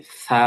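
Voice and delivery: সা with tongue between teeth, breathy th sound; Arabic tha. Shown as a demonstration. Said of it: "এই অক্ষরটা উচ্চারণ হবে". (জিভ দাঁতের ফাঁকে রেখে সা)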